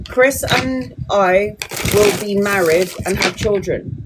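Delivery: slow mock-posh English accent; a touch of posh English accent, slow; posh British. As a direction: reads the question slowly in a put-on posh English accent. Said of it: "talk like this and be a little bit more posh".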